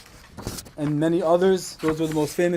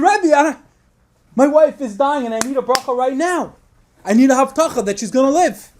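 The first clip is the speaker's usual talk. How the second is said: insistent plea; 4 semitones higher, 5 dB louder than usual. Retold or imitated. Husband insisting on a blessing.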